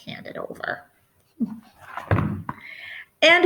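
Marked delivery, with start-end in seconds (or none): 0.0-0.9 breathy unvoiced whisper; a hushed whispered demand. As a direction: hiss it softly like a secret. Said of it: "She said".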